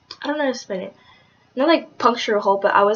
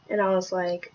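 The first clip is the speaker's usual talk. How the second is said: ordinary delivery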